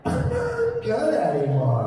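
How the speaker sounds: high, slow banana lament; banana impression. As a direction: stretch it out as an overripe banana. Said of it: "My impression of a banana".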